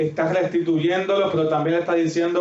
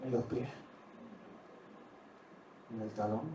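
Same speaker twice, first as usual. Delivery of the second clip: much softer and lower, almost breathed; hushed; 7 semitones lower, 21 dB quieter than usual. Real, not acted. Pastor revealing the wound to the listeners, hushed. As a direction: drop to a hush to let it land. Dramatic answer after long pause.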